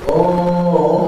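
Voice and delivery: subdued, drawn-out fake "oh" wail; mock crying wail. Shown as a demonstration. Preacher showing a cheaper hired mourner, restrained mock wail. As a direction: give a modest, held mourning moan. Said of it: "Kalau nangisnya".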